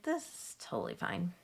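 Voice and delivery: whispered, steady reassurance; unvoiced calm whisper to self. Mom cleaning up sick kid, whispering calm. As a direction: hush it like nothing's wrong.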